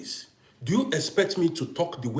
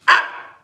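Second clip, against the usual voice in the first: sudden high loud cry of delight; excited shriek; 12 dB louder than usual. Real, not acted. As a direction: yelp with joy. Reaction to the gift.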